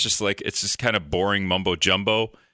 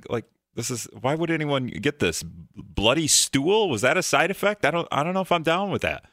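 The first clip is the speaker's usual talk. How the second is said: higher, hammed-up disbelief at side effects; mock incredulous viewer; 6 semitones higher than usual. Viewer hearing a drug ad's side effects, mock incredulous and higher. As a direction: play a baffled viewer who can't believe what he heard. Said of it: "it's always just like".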